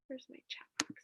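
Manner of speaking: unvoiced whisper to herself; under-breath aside. Real, not acted. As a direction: whisper it while searching the screen. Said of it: "muttering to herself".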